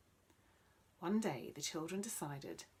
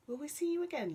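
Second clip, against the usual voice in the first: put-on eager child voice; 9 semitones higher, 7 dB louder, faster than usual. Tim asks eagerly.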